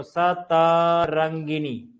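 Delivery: सतरंगिनी stretched syllable by syllable, sə-tə-rəŋ-gi-ni; slow spelled-out word. Shown as a demonstration. Teacher spells the word out slowly for students. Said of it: "ये आएगा सतरंगिनी".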